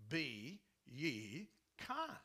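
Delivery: slow, grave Be ye kind; solemn slow command. Shown as a demonstration. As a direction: lay down Be ye kind as a firm order. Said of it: "It's exactly what it says".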